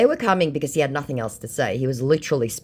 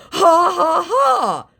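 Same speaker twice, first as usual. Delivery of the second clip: high, loud cackling put-on laugh; mocking the scammer's laugh; 9 dB louder, much slower than usual. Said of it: "was all he could say".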